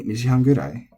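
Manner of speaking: "nijihan gurai" with the accent on gu; pitch accent on gu. Shown as a demonstration. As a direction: drop the accent before gurai, stress gu. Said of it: "delete the accent ... put it on the gu".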